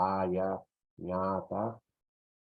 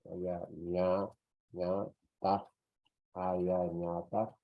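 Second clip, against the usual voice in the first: aya, ña, ta set apart as separate beats; slow classroom dictation; syllable by syllable; slower than usual. Shown as a demonstration. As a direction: dictate aya ñata in pieces. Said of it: "aya, ñata".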